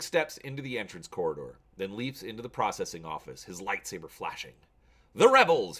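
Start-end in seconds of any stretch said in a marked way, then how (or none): 5.1-5.8 higher and much louder snarl. Acted. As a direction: spit out the battle cry. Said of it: "he snarls".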